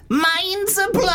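very high squeal of amazement; piercing mock squeal. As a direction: shriek it in a hammed-up falsetto. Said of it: "that really goes through me".

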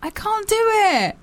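high, drawn-out wail of protest; slow, high-pitched whine; whining protest. Real, not acted. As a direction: complain that it's beyond you, high and stretched. Protesting the accent task.

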